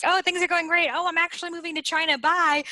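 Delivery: high, fast, bubbly mock gush; flighty vata excitement. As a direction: gush about it, then flit off.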